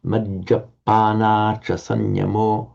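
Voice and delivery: slow "Majjapana" with "pa" and "na" both long; long vowels kept. Shown as a demonstration. Teacher modelling for a student, "Majjapana" with long vowels. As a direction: keep "pana" in "Majjapana" evenly drawn out. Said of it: "it has to remain long".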